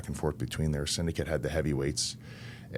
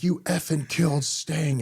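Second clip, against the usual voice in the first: high, slow, louder sobbing accusation pushed harder; imitating crying fan; 11 semitones higher, 4 dB louder, slower than usual. Crying fan repeats it to Vampiro, even more distraught. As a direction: push the accusation further, near tears. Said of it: "he's crying".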